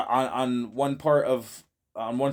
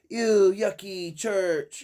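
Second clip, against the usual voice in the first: very slow, higher mock-disgusted mom voice; mom's disgust; 6 semitones higher, much slower than usual. Mom passing a church, slow disgusted mock voice. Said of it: "my mom would say".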